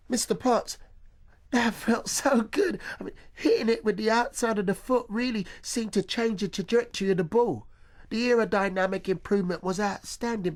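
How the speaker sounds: put-on high, breathless excited boy's voice; young Bob Monkhouse. Boy running to his coach, high and thrilled. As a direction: gush like a thrilled schoolboy comedian. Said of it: "just like a young Bob Monkhouse".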